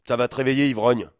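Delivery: loud, high angry taunt; father shoving son under cold shower, shouting; furious father. Acted. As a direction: spit the insult.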